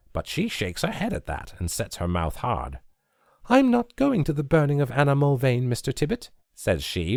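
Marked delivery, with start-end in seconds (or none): 3.5-6.3 put-on high girl's voice, set and firm; firm refusal. A narrator's reading. Nancy refusing to go to the burning, high and firm. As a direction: refuse him flatly, a higher voice. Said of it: "very firm".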